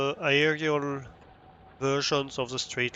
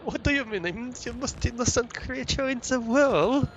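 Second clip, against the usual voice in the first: higher mock-innocent character voice; innocent Guppy voice; 9 semitones higher than usual. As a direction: play the wide-eyed innocent creature, pitched up. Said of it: "the very innocent one of Guppy".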